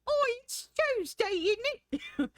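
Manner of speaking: put-on very high, squeaky British accent; British Mickey Mouse. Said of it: "British accent is just Mickey Mouse voice".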